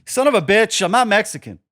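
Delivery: high, loud, hammed-up indignation; mock outrage. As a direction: play offended, loudly. Mock outrage at being called Mexican.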